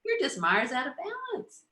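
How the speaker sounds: high, playful talking-down to a feeling; high teasing inner voice; mock confident inner voice. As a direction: sing it out like you caught it. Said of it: "you're like".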